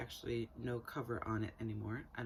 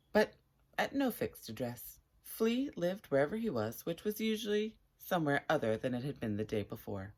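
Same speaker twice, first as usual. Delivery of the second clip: lively, louder read-aloud storytelling; story narration; 7 dB louder than usual. Acted. Reading a chapter to viewers at home, animated storytelling. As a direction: read it like a picture book to an eager audience.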